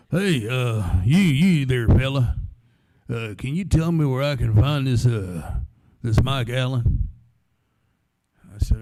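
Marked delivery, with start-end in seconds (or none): 0.1-7.1 loud, slow-ending big Texan fella calling out; good old boy. Retold or imitated. Big fella at the radio broadcast asking for the DJ, loud good old boy voice. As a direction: boom it out like a huge cowboy at a live broadcast. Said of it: "This good old boy".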